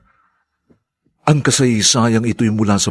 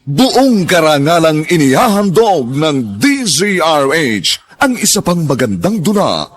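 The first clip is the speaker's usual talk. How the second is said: loud, higher, proud presentation; grand announcer intro; 8 semitones higher, 3 dB louder than usual. Acted. Announcer proudly presents the radio drama, loud and grand.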